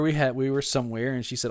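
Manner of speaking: usual speaking voice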